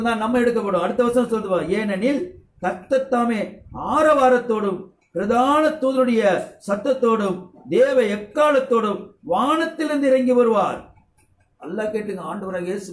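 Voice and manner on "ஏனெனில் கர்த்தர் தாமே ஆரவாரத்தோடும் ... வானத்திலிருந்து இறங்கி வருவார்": slow, rising proclamation of the verse; solemn slow Bible proclamation swelling louder; scripture reading. Acted. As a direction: build it up like a herald. Reads scripture aloud.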